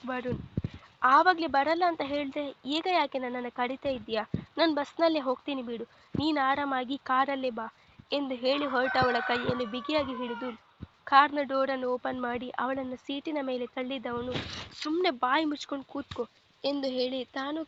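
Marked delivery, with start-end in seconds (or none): 1.0-7.7 loud, fast angry snapping back; wife fires back angrily at her husband, loud and fast; angry retort. Acted. 14.8-16.2 a firm, hard command; angry order. Acted. Husband pushes wife into car.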